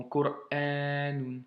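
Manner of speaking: slow "Qur'anun", the "a" held long; drawn-out reading demo of "Qur'anun"; long A after hamza. Shown as a demonstration. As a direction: stop after "Qur", then stretch the "a". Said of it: "qui donne a".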